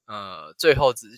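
ordinary talk

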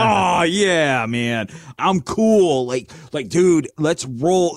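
slow, laid-back bro voice; cocky investor. Retold or imitated. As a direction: drawl it like a cocky dude. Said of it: "They're like".

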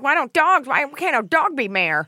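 high, loud whiny dog lover; mock dog lover. Friend mocking a dog lover, in a high whiny voice. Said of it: "you sound like one of those people".

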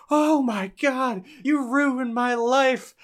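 high, slow, overdone wail; melodramatic teen. Retold or imitated. Imitating the sister wailing at Lola, high and overdone. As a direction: ham up a teen's dramatic despair.

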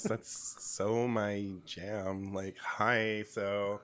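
put-on low, slow valley-girl drawl; a lightly voiced teen girl; Becky voice. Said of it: "I won't be talking like this".

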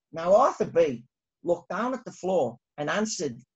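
ordinary delivery

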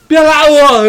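high, loud mock shout; mock drunk yell. Drunk friend being held back, mock shouting. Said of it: "他还在哭".

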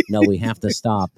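low, stiff insistence; imitated frozen man. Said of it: "he's completely frozen".

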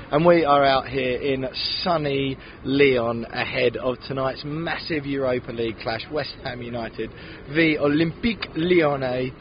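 usual speaking voice

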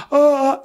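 high, sharp gasps for air; panicked gasps. Acted. Man covering himself before an intruder, acted panicked gasps. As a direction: act out breathless fright in short high bursts. Reenacting watching the motionless figure.

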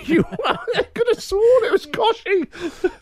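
high laughing, a bit slow. Real, not acted. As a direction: giggle through it. Laughing at himself.

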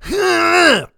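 loud, high straining groan; one hammed-up push of effort; mock birthing grunt. Host acting out a battlefield birth, comic straining groan.

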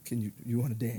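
low, quiet mumbled question; soft shy ask. Retold or imitated. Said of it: "this one was like".

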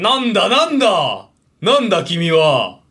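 slow, drawn-out mock indignation; blustering older man. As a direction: bluster like an affronted commuter. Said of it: "ちょっとおじさんやって".

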